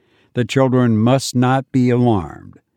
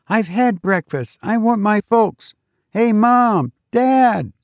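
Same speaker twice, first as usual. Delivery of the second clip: put-on high-pitched child wanting his parents; insistent boy; 10 semitones higher than usual. A narrator's reading. Boy calls for missing parents.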